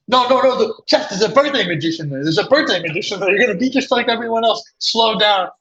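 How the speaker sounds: high, fast, panicked rush; frantic friend. Retold or imitated. Friend in the speeding car panics about the birthday magician, high frantic rush. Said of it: "He's like".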